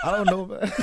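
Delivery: laughing through the words, loud and high. Real, not acted. Laughing at credit card joke.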